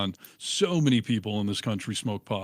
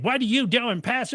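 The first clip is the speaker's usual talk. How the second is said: mock grandma yelling; 12 semitones higher than usual. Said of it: "She's like".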